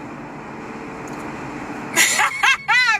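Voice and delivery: long airy wheezing laugh, pitched high; helpless silent laughter; breathless laugh. Real, not acted. Laughing at "I wanted to kiss you.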